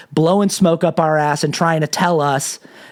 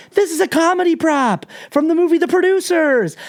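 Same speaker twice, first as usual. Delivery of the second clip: much higher, put-on innocent voice; innocent excuse; 11 semitones higher than usual. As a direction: explain it away with fake cheer. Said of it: "He goes".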